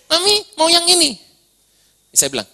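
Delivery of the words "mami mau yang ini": put-on high, pleading little-girl voice; child tugging at her mother, begging for a treat. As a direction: whine for the snack like a small daughter. Daughter begging a third time.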